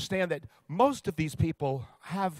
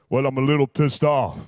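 lower, louder big-man voice; Shaq voice; 4 semitones lower, 8 dB louder than usual. Retold or imitated. Shaq telling Roy how upset he is, in a low booming voice. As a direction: put on a deep, booming Shaq. Shaq answering Roy's interview.

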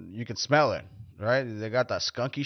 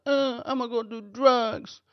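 higher, slow, whiny self-pity voice; mock whiny sufferer; 12 semitones higher, slower than usual. Comic mocking a self-pitying drug user, whiny. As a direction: ham up the poor-me sufferer. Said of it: "like, I'm going".

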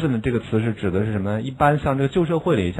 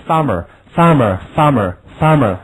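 slow, clear repetition; slow drill reading; 5 dB louder, much slower than usual. Shown as a demonstration. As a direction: read "farmer" again slowly for the class. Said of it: "我们再读一下".

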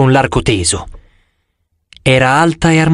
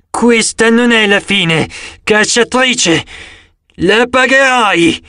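slow, high, strained creature voice; groaning monster; 8 semitones higher, slower than usual. A narrator's reading. Wounded monster threatens the huntress, groaning. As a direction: drag the threat out like a wounded beast.

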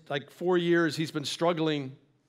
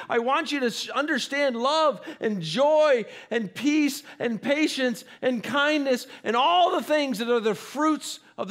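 impassioned preaching; 6 semitones higher than usual. Acted. Preacher speaks God's love to the congregation, impassioned and rising. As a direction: swell into passionate preaching.

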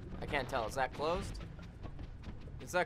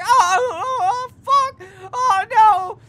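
high, loud hammed-up scream of pain; mock screaming victim; 16 dB louder, slower than usual. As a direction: ham up a blinded man screaming. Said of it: "Screaming bloody murder".

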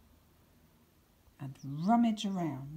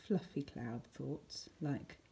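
ordinary delivery

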